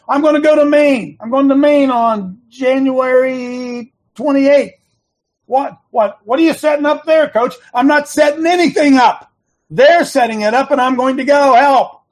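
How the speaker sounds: yelling. Real, not acted. Host yelling about his Maine trip at listeners. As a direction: hammer it home at full volume. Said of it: "I don't mean to yell at you".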